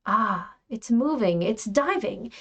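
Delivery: ordinary delivery, nothing marked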